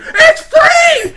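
put-on high, loud excited child's shout; kid yelling. Kid shouting to the whole arcade again, excited yell. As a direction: belt it out like a thrilled kid.

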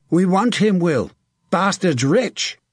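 high, urgent battle shout. A narrator's reading. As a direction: shout to a comrade across the fight. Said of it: "Tom Scarlett shouted".

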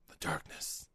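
soft sinister whisper; creepy whisper. Pretend. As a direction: breathe it like a villain. Dark sponsor interjects.

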